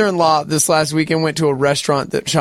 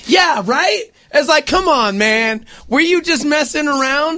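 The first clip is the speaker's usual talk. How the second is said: incredulous; 11 semitones higher than usual. Real, not acted. Mocking restaurant's mix-up.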